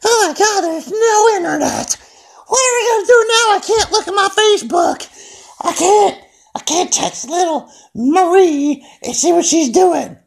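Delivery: very high, panicky mock wail with a laugh; mock freak-out. Mocking people cut off from the internet, high panicky squeal. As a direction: squeal like a phone addict who just lost the internet. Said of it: "People would be like".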